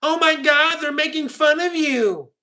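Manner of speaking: high, drawn-out mock dismay; mock taunting. Streamer teasing Fletcher about the chat, high mock taunting. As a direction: rub it in with a high, stretched whine.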